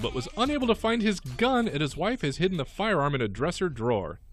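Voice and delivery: lively radio news item; news read. Acted. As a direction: read out the crime story with punch. Reads out a news story.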